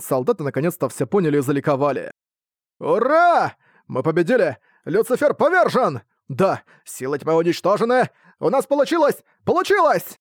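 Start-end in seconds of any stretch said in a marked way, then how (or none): 2.8-10.2 cries of joy. Acted. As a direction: cheer the win. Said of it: "Крики радости".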